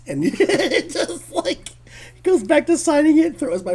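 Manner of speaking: talking through high laughter; can't stop laughing while telling it. Real, not acted. Guest recalls Neil throwing the book, laughing through it.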